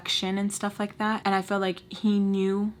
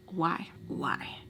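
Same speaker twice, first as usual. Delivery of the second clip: whisper; 3 semitones lower, 7 dB quieter than usual. Retold or imitated. Asking herself in despair.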